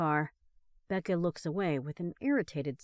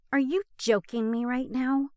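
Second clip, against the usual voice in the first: louder, exasperated complaint; complaining; 5 semitones higher, 6 dB louder than usual. Acted. Passenger complaining at speeding driver, exasperated. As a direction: grumble it at him. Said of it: "Becca complains".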